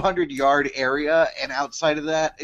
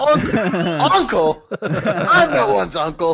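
offended Ben Franklin; 5 semitones higher, 4 dB louder, slower than usual. Pretend. Voicing Franklin offended by name.

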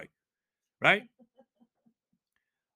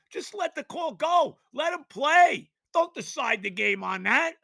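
angry shout; 6 semitones higher than usual. Real, not acted. Fan yelling at officials.

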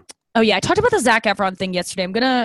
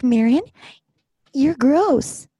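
teasing insult in a louder, higher voice; mock insult to dog; 7 semitones higher, 5 dB louder, much slower than usual. Owner jokingly insulting her dog. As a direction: tease the dog cheerfully. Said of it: "I'm whispering it".

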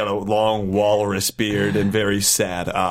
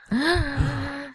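dramatic gasp; 4 dB quieter than usual. Acted. Villain reveal, gasp before quote.

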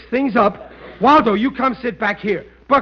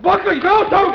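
frantic plea; 12 semitones higher, 3 dB louder than usual. Acted. Riley begs uncle to stop.

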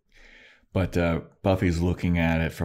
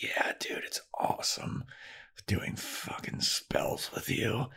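unvoiced, slow whisper; hushed Willow whisper; 4 semitones higher, 10 dB quieter, slower than usual. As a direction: whisper it like a secret, drawn out.

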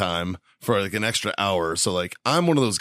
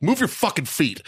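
higher mock command; retold angry demand; 6 semitones higher than usual. Man retelling ordering passenger to move her feet, angry. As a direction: bark it at her across the aisle. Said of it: "I'm like".

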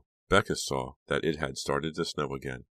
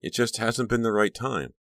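high-pitched, apologetic excuse; mildly pleading, defensive; guilty; 7 semitones higher than usual. A narrator's reading. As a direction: make a guilty excuse, voice lifted. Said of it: "feeling guilty".

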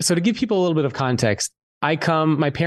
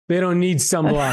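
higher-pitched mock outburst as a scoffing dad; dismissive dad; 4 semitones higher than usual. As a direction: imitate Dad scoffing at the idea. Dad dismisses sunblock in retold exchange.

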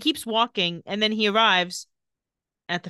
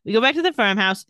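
ordinary talk, nothing marked

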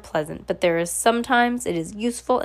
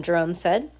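ordinary delivery

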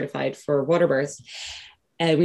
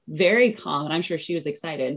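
ordinary talk

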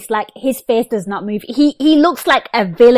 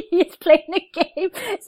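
higher, laughing through the words; amused, laughing; 7 semitones higher than usual. Real, not acted. Host laughing at the guest's remark, amused.

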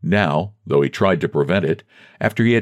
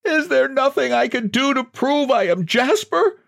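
very high-pitched desperate wail; high, despairing cry for belief; wailing plea. A narrator's reading. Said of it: "He wailed".

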